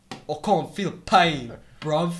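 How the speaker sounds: slow, loud mock British tough guy; British accent. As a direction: drag it out in hammed-up British. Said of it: "now I just do it like how they actually say it".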